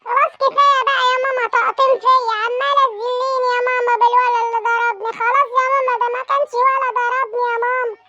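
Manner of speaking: put-on high little boy begging to stop; a kid fed up with the nagging; whiny child voice. Son begging mother to stop nagging, high whiny child voice.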